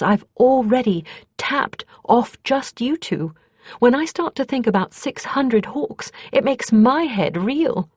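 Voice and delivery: a hint of a sure, brisk sorceress laying out numbers; Kethri, confident mage. A narrator's reading. Kethri briefs Justin on the enemy, lightly voiced, confident.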